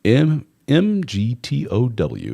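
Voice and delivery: slow letter by letter, M G T O W; spelled out slowly. Shown as a demonstration. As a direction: spell it out deliberately. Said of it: "call themselves mig tow".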